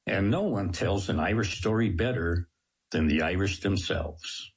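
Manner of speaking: ordinary talk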